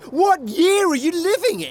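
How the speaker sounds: high exasperated disbelief; incredulous. Acted. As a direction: squeak it in disbelief.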